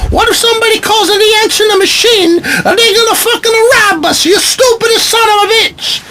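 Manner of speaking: shrieking caricature of an Italian mother, very high; Italian mom voice. Acted. Italian mom scolding son about the answering machine, hammed-up shriek. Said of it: "an Italian voice".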